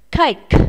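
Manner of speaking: Cake with a strong puff of air on the final K; aspirated ending. Shown as a demonstration. Teacher models Cake for pupils, final K aspirated. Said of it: "Các em đọc lại nào".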